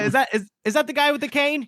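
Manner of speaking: hammed-up worried whisper-fast check; nervous character question. Friends voicing a character spotting the man with the cane.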